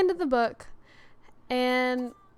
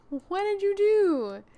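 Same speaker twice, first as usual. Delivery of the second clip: higher, drawn-out questioning of a culprit; exasperated scolding; 7 semitones higher, 5 dB louder, slower than usual. Real, not acted. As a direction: demand an explanation, slowly and higher. Scolding a game character's behaviour.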